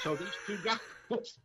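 slow, high words through laughter; laughing. Real, not acted. Co-host retelling the two-dads story, laughing. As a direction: laugh your way back into the story.

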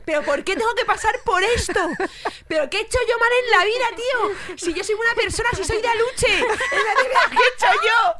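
high, loud laughing lament; mock despair. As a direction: wail to the heavens half joking. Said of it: "mirando a mi novio en plan".

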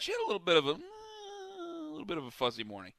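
high, thin, whiny put-on voice; a mock sad whimper about the hangover.

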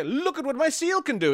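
high, eager showman voice; showman boast. Acted. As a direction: show off the trick proudly. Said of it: "They go, come along and say".

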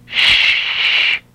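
one sustained hushing sound; long shush. Pretend. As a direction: shush the other person at length. Shushes the telemarketer.

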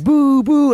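high, loud "boo, boo"; imitated crowd boo. Imitating a tennis crowd for listeners, loud high booing. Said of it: "it sounds like they're booing".

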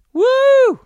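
very high, loud whoop; mock cheer. Mocking sport as ridiculous, high whooping cheer. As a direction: whoop like a crowd fan.

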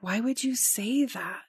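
soft, slow inner voice asking why; a quiet reproach from within; gentle rebuke. Retold or imitated. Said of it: "get called out by the Spirit. Like".

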